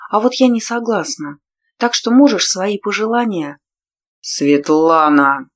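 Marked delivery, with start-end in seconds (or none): 4.2-5.5 slow, loud roared name. A narrator's reading. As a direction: bellow the name in rage. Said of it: "взревел Тарлан".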